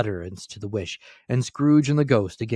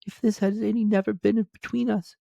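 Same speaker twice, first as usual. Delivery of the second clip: much higher woman's voice; the girl releases young Scrooge, higher voice; grieving young woman; 12 semitones higher than usual. A narrator's reading. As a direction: voice the girl sorrowfully, pitched high. Girl's voice.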